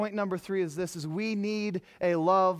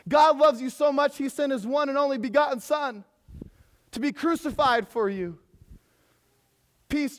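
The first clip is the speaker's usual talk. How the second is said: higher, louder preaching build; full-force preaching; 6 semitones higher, 4 dB louder than usual. Acted. Pastor preaching love to the congregation, full force. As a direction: drive it home to the crowd.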